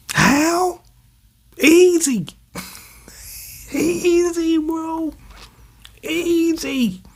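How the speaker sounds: hammed-up high spirit voice; high creepy spirit voice. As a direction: whisper it high and eerie like a monster. Mock voicing a cursed spirit.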